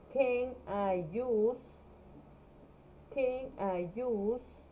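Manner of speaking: very slow, careful phrasing; slow model phrase. Shown as a demonstration. Teacher assembles the question slowly for her class. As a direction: build the phrase slowly for students. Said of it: "mi verbo use".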